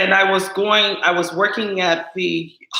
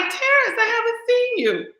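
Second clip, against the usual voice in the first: excited greeting. Retold or imitated. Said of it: "I'm like".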